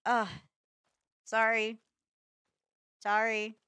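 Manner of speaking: usual speaking voice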